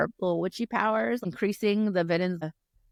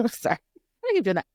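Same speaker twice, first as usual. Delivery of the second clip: ordinary delivery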